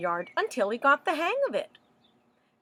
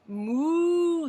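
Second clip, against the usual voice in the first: higher, put-on mooing voice; storybook cow moo; 7 semitones higher than usual. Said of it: "said Cow".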